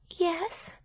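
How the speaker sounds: small, high, soft sad voice. A narrator's reading. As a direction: admit it softly and sadly. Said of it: "Gracie said sadly".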